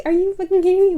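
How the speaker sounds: high, loud, incredulous outburst; retelling her outburst at the client, high and loud disbelief; outraged disbelief. Retold or imitated. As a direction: blurt it out in total disbelief.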